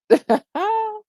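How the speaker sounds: high-pitched burst of laughter. Real, not acted. Laughs at the story.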